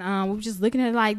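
ordinary delivery

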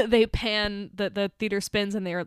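usual speaking voice

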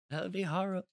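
high mock London accent, swallowed final L. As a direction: imitate a Londoner dropping the L. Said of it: "they can't say the L".

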